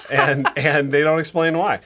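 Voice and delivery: laughing through the joke. Real, not acted. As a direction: chuckle through the words. Joking after mispronunciation.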